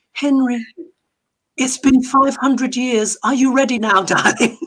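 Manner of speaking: lightly voiced angel sweetly coaxing; gentle angel. Angels gently coaxing Henry to move on, mock tender. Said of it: "the angels are like".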